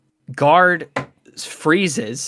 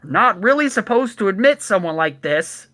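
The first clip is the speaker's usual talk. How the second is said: higher guard voice calling down; reluctant gate guard; 9 semitones higher than usual. Acted. Guard declining to admit a late cart, raised voice. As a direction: refuse from up on the wall. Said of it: "And the voice just says".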